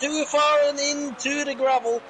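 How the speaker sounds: high, loud excited call; excited commentary. Real, not acted. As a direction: shout as he goes off. Driver runs into the gravel.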